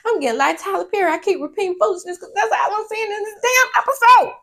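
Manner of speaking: loud, high exasperated complaining; high excited rant. Real, not acted. Host fed up with her own repeating, exasperated. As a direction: throw up your hands and rant. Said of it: "I almost said foolishness again".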